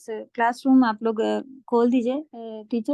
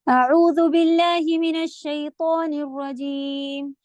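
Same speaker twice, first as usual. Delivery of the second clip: slow, measured Quranic recitation; 3 semitones higher, 3 dB louder, much slower than usual. Shown as a demonstration. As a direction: recite the opening formula slowly. (آہستہ تلاوت)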